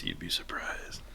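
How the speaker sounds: whispered imitation of a preacher's reply; knowing hush. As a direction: drop to a confiding whisper.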